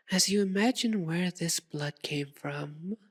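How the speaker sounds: normal talking voice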